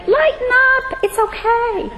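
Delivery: high, lilting reassurance to younger women; sing-song, high-pitched pep talk; lighthearted encouragement. Retold or imitated. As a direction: jump way up in pitch, cheering someone on. Encouraging words voiced to younger women.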